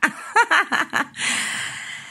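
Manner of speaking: high-pitched burst of laughter; real laugh. Real, not acted. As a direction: break into a long, high giggling laugh. Laughs after naming the mirrors topic.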